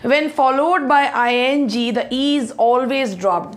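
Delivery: letters spelled out slowly; slow reading. Shown as a demonstration. Teacher spelling out a rule, slowly.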